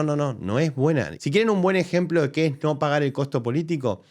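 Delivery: ordinary talk, nothing marked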